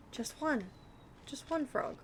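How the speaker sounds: little wheedling chant, stress on one; pleading sing-song. Pretend. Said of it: "Am I pleading with the game?".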